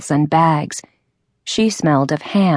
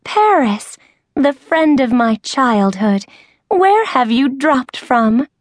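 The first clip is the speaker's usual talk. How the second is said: high, gushing delight; squealing with joy at the reunion; overjoyed greeting; 7 semitones higher than usual. A narrator's reading. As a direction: gush over a long-lost friend. Short woman overjoyed at reunion.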